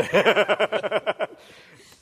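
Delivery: big loud burst of laughter; hearty laugh. Real, not acted. Pastor laughing at his joke about Rodney, loud hearty laugh.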